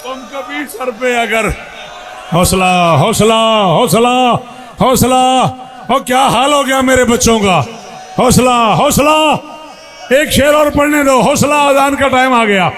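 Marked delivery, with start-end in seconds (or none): 2.3-12.7 preaching at full force. Real, not acted. Calming weeping crowd.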